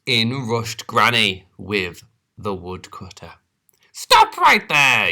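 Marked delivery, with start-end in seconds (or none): 3.9-5.1 high, loud, slow command; yell. Acted. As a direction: halt the wolf. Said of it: "yelled Granny".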